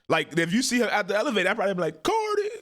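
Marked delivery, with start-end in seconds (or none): 2.0-2.5 high, sing-song call across the hall; calling out a name. Pretend. Fan calling to a celebrity at the elevator, high playful call. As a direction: call her name to catch her attention.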